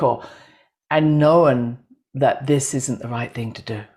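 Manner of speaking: ordinary talk, nothing marked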